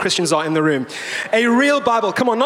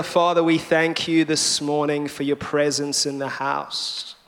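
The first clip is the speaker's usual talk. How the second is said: prayer; 3 semitones higher than usual. Real, not acted. Pastor praying for the church after the sermon opening, earnest prayer. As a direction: pray over the room, unhurried.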